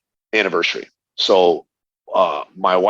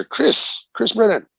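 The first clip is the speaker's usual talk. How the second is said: high, carrying call of his name; calling out across a pool; 13 semitones higher, slower than usual. Retold or imitated. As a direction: shout the name from far off, pitched way up. Someone calling him across pool.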